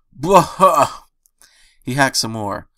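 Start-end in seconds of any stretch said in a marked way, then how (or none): 0.1-1.0 gagging retch sound. Acted. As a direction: hack it out like vomiting. Sick pony retching.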